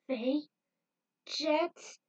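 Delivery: high, pleading call; terrified. A narrator's reading. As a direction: call their names in fear. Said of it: "She was terrified".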